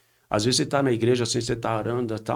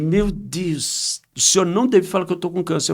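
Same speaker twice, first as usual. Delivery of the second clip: higher, louder indignant scolding; reproachful daughter, raised voice; retold angry scolding; 6 semitones higher, 5 dB louder than usual.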